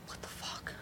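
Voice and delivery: whispered under the breath; a stunned whisper, hidden from the phone; shocked whisper. Retold or imitated. Whispering to herself after the ex calls.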